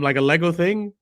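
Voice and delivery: ordinary talk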